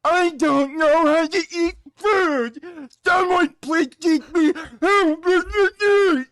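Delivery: high, slow whining mockery with fake sobbing; mocking whiny voice. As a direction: whine it like a helpless crybaby. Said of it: "make some bitchy, dumb, whiny ass post".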